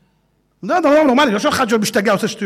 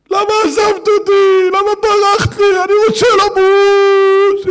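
high, loud, slow heartbroken wail; mock sad song; 10 semitones higher, 6 dB louder, slower than usual. Imitating a sad song on the car radio, mock wailing. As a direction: ham up a weepy breakup song. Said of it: "איזה שירים".

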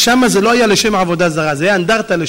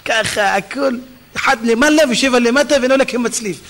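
normal talking voice

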